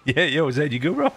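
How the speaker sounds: normal talking voice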